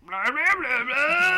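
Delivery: loud gurgly blah noises; stomach growl. Retold or imitated. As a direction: mimic a rumbling stomach out loud. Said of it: "your stomach's like".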